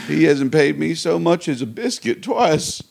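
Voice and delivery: normal talking voice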